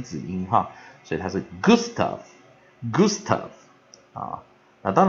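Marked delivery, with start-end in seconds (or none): 1.6-3.5 "Gustav" ending in an f sound, said slowly; slow "Gustav", the final v turned to f. Shown as a demonstration. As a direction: finish "Gustav" with an unvoiced f. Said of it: "它的V还念成f".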